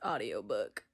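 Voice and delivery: low, soft announcer voice on audiobook; deep announcer. Pretend. Introducing the audiobook, deep announcer voice. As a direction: drop into a deep radio voice.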